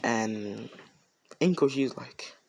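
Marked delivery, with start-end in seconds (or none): none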